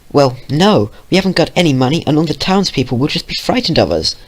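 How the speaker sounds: put-on young girl voice. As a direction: explain it like a worried teen. Twilight explaining.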